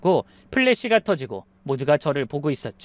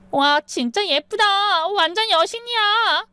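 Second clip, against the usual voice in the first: much higher, slow, drawn-out admiration; gushing friend; slower than usual. Acted. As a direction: coo it like an awed girlfriend. Friend gushes at the bride.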